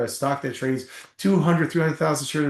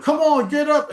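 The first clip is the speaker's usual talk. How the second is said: much higher, louder impatient coach bark; coach yelling; 13 semitones higher, 4 dB louder than usual. Retold or imitated. Coach yells at the player with the snapped leg, loud impatient bark. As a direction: shout at the injured player to get moving. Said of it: "Coach was like".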